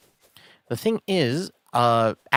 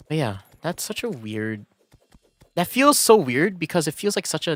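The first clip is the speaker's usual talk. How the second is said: ordinary delivery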